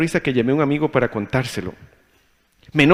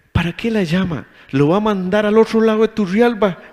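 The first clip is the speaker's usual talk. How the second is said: higher, louder exasperated inner voice; choleric grumbling; 7 semitones higher, 3 dB louder than usual. Retold or imitated. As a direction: grumble it like an impatient hothead. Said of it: "Y mi mentalidad colérica digo".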